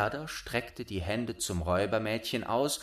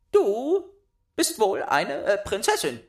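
put-on much higher, loud child voice; bossy little robber girl; 12 semitones higher, 7 dB louder than usual. A narrator's reading. Robber girl claiming Gerda, high bossy child voice. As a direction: demand it like a spoiled robber girl.

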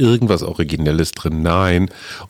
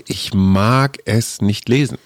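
normal talking voice

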